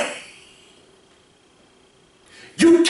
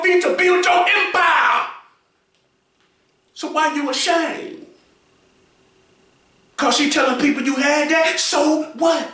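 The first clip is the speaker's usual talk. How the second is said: preaching; 5 semitones higher than usual. Acted. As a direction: preach it to him at full force. Preaching advice at full force.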